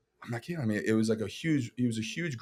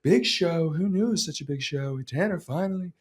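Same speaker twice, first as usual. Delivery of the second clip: Donald Trump; 7 semitones higher than usual. Pretend. Former president congratulating an actor on his show, mock impression. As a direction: put on a boastful president's announcement voice. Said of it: "Former president Donald".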